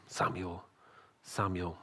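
low, soft calling; hushed night call. Acted. Voice comes to Samuel one night, hushed calling. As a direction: call the name quietly, leaving space.

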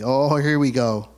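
ordinary talk

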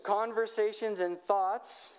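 slow, lifted prayer line for repeating; slow led prayer. Shown as a demonstration. Pastor leading the congregation in a slow call-and-repeat prayer. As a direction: pray it slowly so they can echo. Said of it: "just repeat after me".

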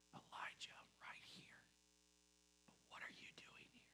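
unvoiced close whisper. God whispering to Elijah at the cave mouth, hushed whisper. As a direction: whisper it right next to his ear. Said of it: "the type of whisper".

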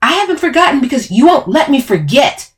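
loud, high angry retort; yelling back. A narrator's reading. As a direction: yell back in self-defence. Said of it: "I yelled back".

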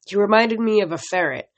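usual speaking voice

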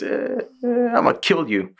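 high squeaky stammer; mock demon. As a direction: stutter like a flustered demon. Mimicking demon.

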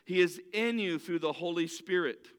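ordinary talk, nothing marked

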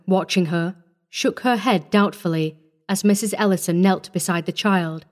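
usual speaking voice

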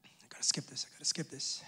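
soft unvoiced whisper to self; whispered aside. Real, not acted. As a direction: whisper it to yourself. Private aside during sermon.

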